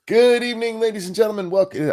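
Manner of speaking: high, smooth radio host greeting. Pretend. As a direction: announce it like a late-night DJ. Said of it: "I gotta put on my radio voice".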